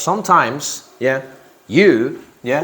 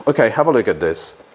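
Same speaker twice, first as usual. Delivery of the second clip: usual speaking voice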